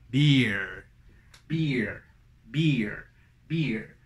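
"Beer" with a clear ee gliding to r; deliberate, separate "Beer" on ee; pronunciation of beer. Shown as a demonstration. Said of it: "Esa sería la pronunciación". ("Beer" con I)

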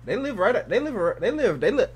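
tripping over the words, restarting each time; stuttering. Real, not acted. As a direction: stumble over the same opening words repeatedly. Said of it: "I'm stuttering".

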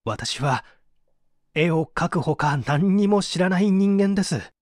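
ordinary delivery

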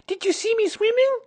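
very high, excited squeak; tiny child bursting to be noticed; Roo. A narrator's reading. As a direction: squeal it out eagerly. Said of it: "squeaked Roo excitedly".

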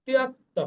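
ত্যক্ত with a clear half k and t; Sanskrit pronunciation. Shown as a demonstration. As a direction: say ত্যক্ত as tyakta, not Bengali style. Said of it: "Bangla মতো কিন্তু আমরা ত্যক্ত বলবো না".

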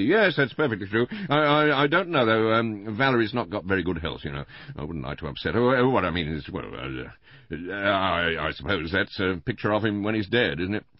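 high, loud, hesitant husband; Mister Bland, flustered. A narrator's reading. Husband shielding his wife from the inspector, flustered. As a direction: fluster and stumble over the excuses.

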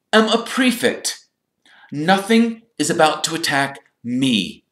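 high, loud pomposity; self-important. A narrator's reading. Percy boasting that he is safe, self-important. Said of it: "drawing himself up".

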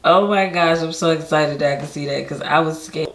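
ordinary talk, nothing marked

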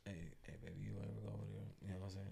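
very soft, barely audible mumbling; low mumble. Shown as a demonstration. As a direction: mumble it quickly under the breath. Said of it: "usually my regular".

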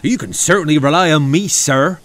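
quick, loud, eager promise; keen to please the boss; happy. A narrator's reading. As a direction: pledge it cheerfully, high and fast. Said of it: "said Oliver happily".

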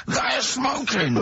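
very high, drawn-out mock protest; retold complaint, slow and high-pitched; exasperated complaint. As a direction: stretch each word in exaggerated indignation. Retold row, exasperated repeat.

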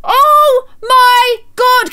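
high drawn-out screaming; panicked shriek. Real, not acted. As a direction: shriek in disbelief. Fire spreads.

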